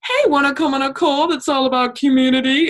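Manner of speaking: high, bright sales-pitch voice; high mocking sales pitch; mock cheesy pitch. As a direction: parody a pushy recruiter. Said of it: "Don't just be like".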